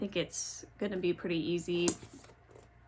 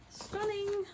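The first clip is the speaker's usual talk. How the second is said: much higher, soft and far-off awed gasp of praise; awed gush; 12 semitones higher, 4 dB quieter than usual. Real, not acted. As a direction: swoon over the word Stunning. Admiring a book cover.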